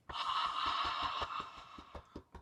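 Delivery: long soft breathy exhale; dramatic sigh. Shown as a demonstration. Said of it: "the heart sound".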